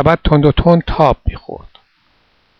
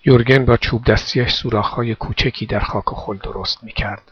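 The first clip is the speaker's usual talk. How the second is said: ordinary delivery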